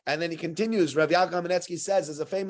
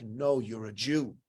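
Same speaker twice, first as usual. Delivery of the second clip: cold dismissal; 7 semitones lower, 5 dB quieter, slower than usual. Retold or imitated. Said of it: "they're like".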